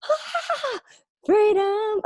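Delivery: high, breathy cry of relief; blissful sigh. Retold or imitated. To her followers, about the fee, a high delighted cry of freedom. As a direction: sing out the word freedom in delight. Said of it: "I'm literally like".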